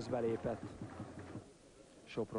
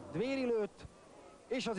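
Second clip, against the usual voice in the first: excited; 10 semitones higher than usual. Real, not acted. Commentator reacts to a shot.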